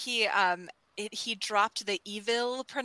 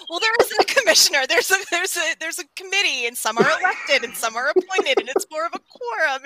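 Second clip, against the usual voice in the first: higher, louder, fast, flustered rambling explanation; flustered police chief; 7 semitones higher, 6 dB louder than usual. Retold or imitated. Podcaster imitating police chief explaining who he reports to, fast and flustered. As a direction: rattle it off like a nervous chief making excuses. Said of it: "And it's just the delivery".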